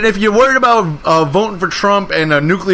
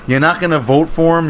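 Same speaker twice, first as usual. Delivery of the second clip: ordinary delivery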